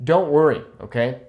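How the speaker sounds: normal talking voice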